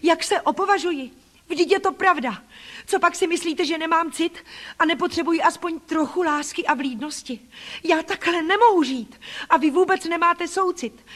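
put-on a wronged child letting it all out; passionate reproach. Child pours out grievances.

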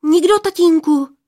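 high, loud anxious denial; hasty blurt. A narrator's reading. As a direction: blurt it out to cover up. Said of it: "vyhrkla Poměnka".

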